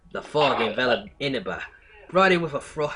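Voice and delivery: reading aloud with a Brooklyn accent. Real, not acted. Streamer starting fan fiction, accidental Brooklyn accent. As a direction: accidentally slip into Brooklyn while narrating.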